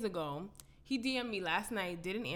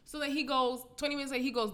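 ordinary delivery

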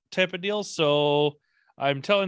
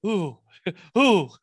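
laughing Minnesota "ooh", higher and louder; mock Minnesota accent; 5 semitones higher, 5 dB louder than usual. Host imitates guest Lee's Minnesota accent while laughing. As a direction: tease a friend's Minnesota accent, giggling. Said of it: "how much of a Minnesota accent Lee has".

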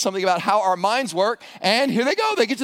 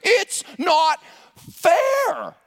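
"It's not fair" very high and very slow, sulky; whining complaint; 10 semitones higher, 3 dB louder, much slower than usual. Acted. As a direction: drag out "fair" like a sulking child. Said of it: "what is implied here".

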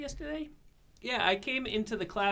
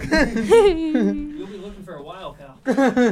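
laughing; 5 semitones higher, 8 dB louder, much slower than usual. Real, not acted. Joking retort to classmate.

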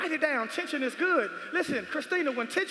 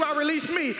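usual speaking voice

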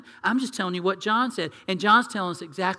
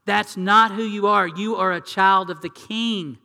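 louder, proclaiming firmly; emphatic declaration; 3 semitones higher, 8 dB louder, slower than usual. Retold or imitated. Pastor voices John declaring identity, loud and firm. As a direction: shout it like an exclamation point. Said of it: "That's why John, exclamation point".

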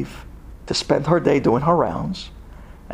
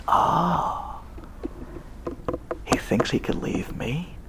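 quiet menace; 9 dB quieter, slower than usual. Retold or imitated. Acted sinister reaction before incantations.

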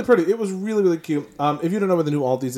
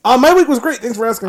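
high, loud sing-song boast; mock chipper; 10 semitones higher, 7 dB louder than usual. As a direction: announce it brightly unasked. Answers before being asked.

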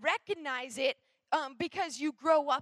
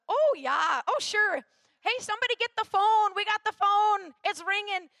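loud, high Minnesota accent, hammed up; thick Northern accent; 4 semitones higher, 8 dB louder than usual. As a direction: belt it out in a broad Northern accent. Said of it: "in a thick, uh, Northern accent".

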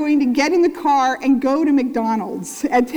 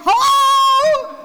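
high, loud impatient shout at the window; yelling hello; 9 semitones higher, 5 dB louder than usual. Retold or imitated. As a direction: yell it through the glass, fed up with waiting. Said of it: "And I started yelling".

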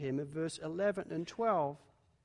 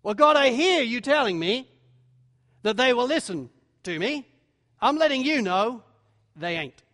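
audacious; 3 semitones higher, 7 dB louder than usual. Acted. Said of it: "his back is straightened a little bit".

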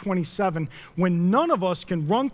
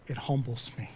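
near-whispered, much softer, letting it sink in; a hushed, humbled admission; hushed confession; 10 semitones lower, 10 dB quieter than usual. Real, not acted. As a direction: drop the voice right down on "It humbles me". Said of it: "I have been humbled".

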